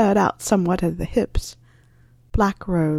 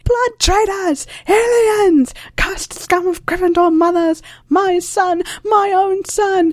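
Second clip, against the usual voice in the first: very high, louder, slow hysterical screech; shrieking portrait woman; 11 semitones higher, 4 dB louder, slower than usual. Acted. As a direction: scream the insults like a deranged old portrait. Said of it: "The high-pitched woman's voice".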